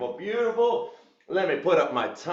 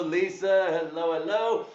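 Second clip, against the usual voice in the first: ordinary talk, nothing marked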